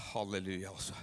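halleluja, low and soft. Real, not acted. Pastor calming himself after getting excited, soft and low. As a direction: turn yourself down on halleluja. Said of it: "bare skru meg ned".

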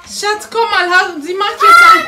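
yelling across the room. Real, not acted. Mutter ruft Vater laut.

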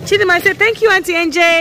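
loud, sing-out parental prompt. Real, not acted. Prompts child to thank auntie.

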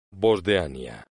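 normal talking voice

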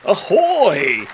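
loud high pirate ahoy; pirate shout. Pretend. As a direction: bellow it like a pirate. Pirate-themed episode, first mate greets.

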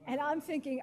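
ordinary talk

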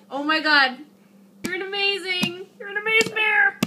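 high excited gushing; excited praise. Real, not acted. Praising baby's crawl.

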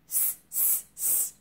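soft hissed monkey chatter; short voiceless tss noises; monkeys' tss. A narrator's reading. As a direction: mimic monkeys with quiet hisses. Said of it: "and said".

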